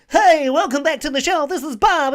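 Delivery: high, loud, over-the-top radio host; ridiculous radio voice. Retold or imitated. Mocking radio hosts opening their show, dumb ridiculous voice. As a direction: boom it out like a mock DJ opening the show. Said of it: "put on this, like, dumb, ridiculous voice".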